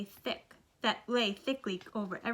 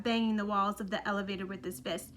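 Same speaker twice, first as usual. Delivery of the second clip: ordinary delivery, nothing marked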